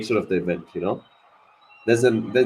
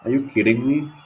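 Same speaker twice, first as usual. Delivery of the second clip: disbelieving laugh; 4 dB quieter than usual. Real, not acted. Wicket falls, stunned disbelief.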